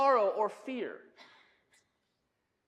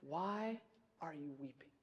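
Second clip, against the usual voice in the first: Jesus's gentle question; 8 semitones lower, 15 dB quieter than usual. Acted. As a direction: ask it hushed, with space after the first word. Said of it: "But Jesus asked the same question".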